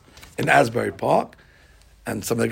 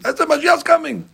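usual speaking voice

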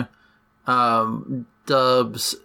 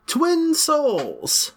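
dramatic title read; 13 semitones higher than usual. Acted. Reads the alternate title grandly.

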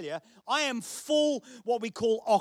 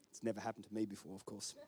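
much softer and quick, tossed off under the breath; mumbled joking aside; 14 semitones lower, 17 dB quieter, faster than usual. Real, not acted. Pastor confiding a joke to the congregation, hushed and quick. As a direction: slip it in fast and hushed like a private joke.

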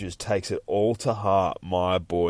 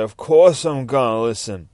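Guppy retelling the judge; 3 semitones higher, 8 dB louder than usual. Acted. Voicing the judge via Guppy.

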